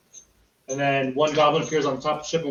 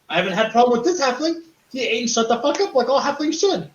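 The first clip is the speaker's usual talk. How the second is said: goblin scolding; 7 semitones higher than usual. Acted. Goblin NPC berating halfling.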